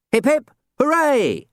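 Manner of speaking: high loud hooray; team cheer. Acted. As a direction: shout it like a team.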